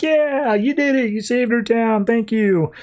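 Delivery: very high, excited cheer that eases down; cheering crowd. Acted. Crowd outside the hospital cheers Ray, high and excited. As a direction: cheer him like a grateful crowd.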